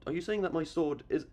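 ordinary talk

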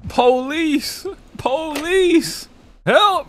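high, drawn-out frightened calling; panicked cry for help. Real, not acted. Scared player yells for police and help, panicked cry.

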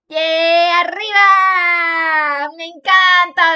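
loud, drawn-out cheer. Real, not acted. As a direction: cheer it out slowly. Cheering shout. (grito de ánimo alargado)

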